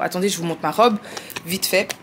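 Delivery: normal talking voice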